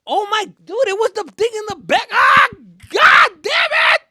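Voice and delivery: very high, loud strangled cry; exasperated yell. Real, not acted. Storyteller reliving his own dumb mistake, anguished shout. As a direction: howl it out in frustration.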